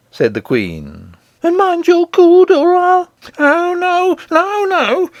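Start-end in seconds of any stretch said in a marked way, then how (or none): 1.4-3.1 very high, loud warning; menacing queen, pitched far up; threatening queen. A narrator's reading. 3.4-5.1 very high, pleading wail; frightened king imploring; supplicating scream. A narrator's reading. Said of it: "screamed the King in the most supplicating of tones".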